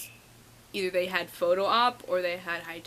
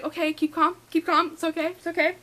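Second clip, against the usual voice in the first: high, tight self-talk; acted-out squeaky attempt at calm; panicky self-calming; 8 semitones higher than usual. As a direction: talk yourself down while panicking. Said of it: "So I'm like".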